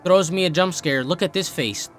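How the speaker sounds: ordinary delivery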